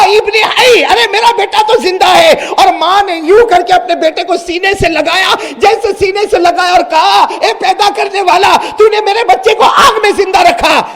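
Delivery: high, loud ecstatic crying out; joyful thanks. Acted. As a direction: cry out thanks to God. Mother rejoices thanking God. (خوشی سے پکارتی ماں)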